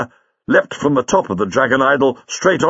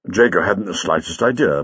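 usual speaking voice

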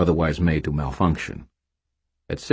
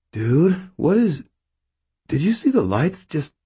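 confused stoner; 11 semitones higher than usual. A narrator's reading.

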